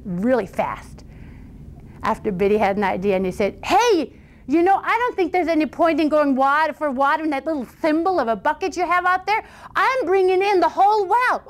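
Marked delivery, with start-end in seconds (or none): 3.6-11.4 put-on bragging boy. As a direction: brag big, ending in a shout. Boy bluffs about the well.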